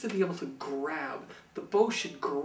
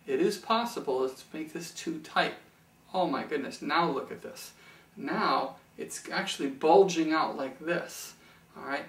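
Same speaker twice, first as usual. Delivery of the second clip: ordinary delivery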